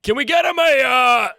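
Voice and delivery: high shouted deli clerk voice; loud bodega clerk. Pretend. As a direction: yell the order across the counter. Bodega clerk puppet shouting order.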